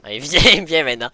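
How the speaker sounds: ordinary talk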